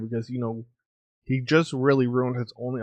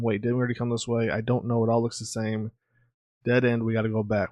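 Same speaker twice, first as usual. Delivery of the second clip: ordinary talk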